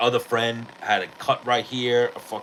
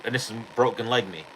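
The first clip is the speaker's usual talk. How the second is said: ordinary delivery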